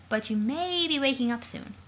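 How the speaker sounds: ordinary talk, nothing marked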